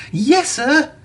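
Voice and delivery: bright high eager reply; a proud young engine eagerly answering; excited. A narrator's reading. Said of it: "said Thomas excitedly".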